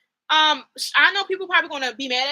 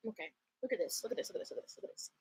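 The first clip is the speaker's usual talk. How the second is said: soft breathy repeated look at this; hushed urgency; 3 semitones lower, 16 dB quieter, much faster than usual. Real, not acted. Fan leaning in to show the scene, hushed. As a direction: pull them in close, hushed and eager.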